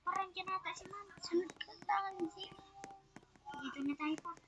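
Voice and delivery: soft hushed whisper. Real, not acted. Hiding from teacher in dark.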